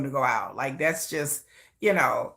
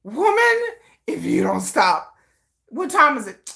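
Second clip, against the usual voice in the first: put-on exasperated boyfriend; 4 semitones higher than usual. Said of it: "He's like".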